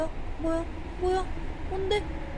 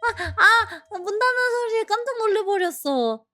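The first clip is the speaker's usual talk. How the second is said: relieved excitement; 8 semitones higher, faster than usual. Real, not acted. Relief at game end.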